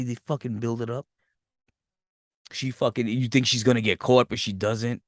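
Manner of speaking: ordinary talk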